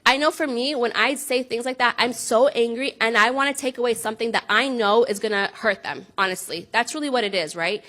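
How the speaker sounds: ordinary talk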